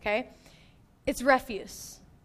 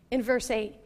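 ordinary talk

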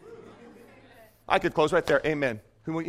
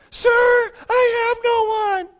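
high, slow, far whiny complaint; mock the sick man whining about the pool; whiner; 14 semitones higher, 4 dB louder, slower than usual. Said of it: "that's what whiners sound like".

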